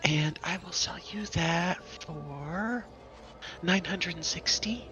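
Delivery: high character voice, halting on the price; potion seller. Acted. Seller asking what effects customers want, high character voice. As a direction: ask helpfully in a high shopkeeper's voice.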